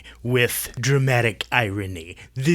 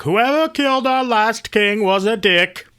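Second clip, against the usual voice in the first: high mocking paraphrase of the king; mock Oedipus; 7 semitones higher than usual. Host mocking Oedipus cursing himself, high and jokey. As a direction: lampoon the king's speech in a high voice. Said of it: "He's like".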